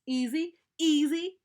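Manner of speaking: high, loud indignant protest. Acted. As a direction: shoot back offended. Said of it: "protested Brother".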